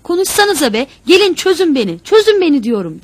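high, very loud, desperate demand; furious, urgent shout from a captive. A narrator's reading. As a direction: yell to be untied. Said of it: "diye bağırdı".

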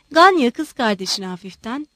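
ordinary delivery, nothing marked

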